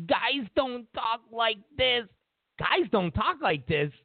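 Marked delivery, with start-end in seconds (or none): 0.1-2.1 slow, drawn-out mock denial; imitating a denial, slowly and derisively; mocking quote. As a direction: stretch it out to ridicule whoever said it. Mocking others' denial, first slow take.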